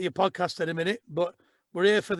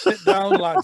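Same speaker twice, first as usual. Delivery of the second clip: mock sit down; 11 semitones higher, 4 dB louder than usual. Host mimics the home fan.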